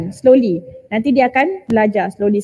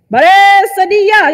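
barked like a drill command, louder and higher; mock commando order; 6 semitones higher, 6 dB louder, slower than usual. Friend imitating a commando's order, loud and clipped. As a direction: call it out like a drill sergeant. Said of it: "macam komando".

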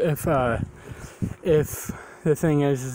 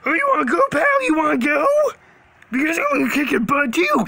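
high-pitched taunting challenge; combative shark hunter; 10 semitones higher, 4 dB louder, faster than usual. Acted. Hunter daring a pal to fight, combative. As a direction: pick a fight in a high character voice.

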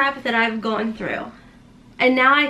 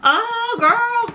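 playful exclaim; 10 semitones higher than usual. Real, not acted. Teasing herself about tearing up.

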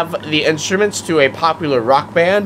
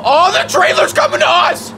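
surprised shout. Real, not acted. Gamer yelling when the trailer rolls at him, startled shout.

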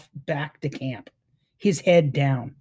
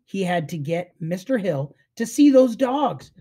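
ordinary delivery, nothing marked

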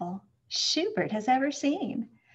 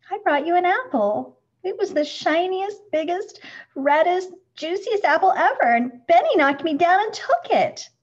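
high, loud tumbling complaint; wronged child telling on the bully; upset outburst; 7 semitones higher, 7 dB louder than usual. A narrator's reading. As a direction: pour it all out in a rush of hurt. Upset child pours out his story.

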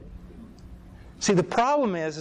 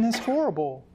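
normal talking voice

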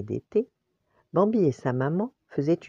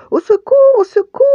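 high, loud scream for help; cri au secours; 13 dB louder, faster than usual. A narrator's reading. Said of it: "hurla soudain Pampan". (hurlement aigu d'appel au secours)

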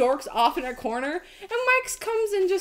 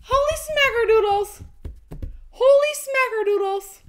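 amazed exclamation; 6 semitones higher than usual. Real, not acted. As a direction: exclaim it in high-pitched amazement. Amazed at the donation.

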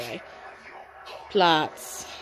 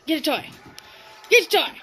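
normal talking voice

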